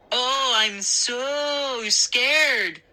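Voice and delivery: slow, drawn-out mock fear; sarcastic. A narrator's reading. Boy teasing the angry girl, slow sarcasm. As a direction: tease her by pretending to be scared. Mocking Mindy's threat.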